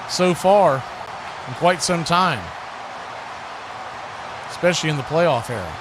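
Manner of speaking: normal talking voice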